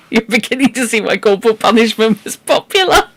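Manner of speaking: talking through laughter; amused, laugh breaking into the words. Real, not acted. Reader despairing of these children, laughing.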